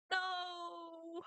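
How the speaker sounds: long, high, drawn-out cry of denial; dismayed wail. Real, not acted. Gamer learning a favorite character is dead, dismayed wail. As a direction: wail it out on one held high note.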